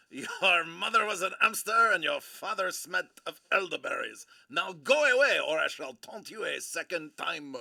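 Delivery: loud hammed-up French accent, Monty Python style; outrageous French accent. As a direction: overdo the French accent for laughs. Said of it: "this outrageous accent".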